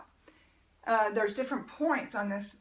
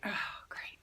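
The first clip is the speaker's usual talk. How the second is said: whispered sarcastic aside; under-breath grumble about the evening being ruined; 9 dB quieter than usual. Pretend. Sarcastic aside after a spouse's comment.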